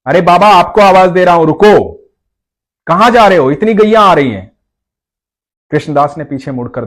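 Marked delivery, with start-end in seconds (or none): 0.1-4.6 put-on higher, louder boy's call; boy calling again more insistently; child calling again. A narrator's reading. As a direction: call him back, a bit more insistent. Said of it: "बालक ने फिर आवाज दी".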